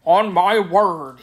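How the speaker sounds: mild, drawn-out exclamation. A narrator's reading. Little man recognizing the maiden, slow mild exclamation. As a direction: exclaim it slowly, with a touch of wonder. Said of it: "he exclaimed".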